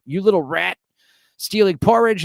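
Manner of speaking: a nurse catching a boy stealing, mock scolding. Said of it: "She calls him a rat".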